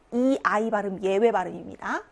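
ordinary talk

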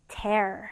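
"tear" said like "tare", with an open eh; verb pronunciation. Shown as a demonstration. Teacher shows students the verb, "tear" pronounced like "tare". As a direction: say "tear" to rhyme with "air". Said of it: "태 발음이요".

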